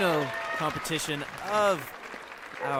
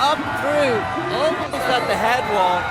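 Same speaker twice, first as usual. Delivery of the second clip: much higher, loud climax call; excited play-by-play; 7 semitones higher, 7 dB louder than usual. Real, not acted. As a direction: build to a shout at the top. Climax of the climb.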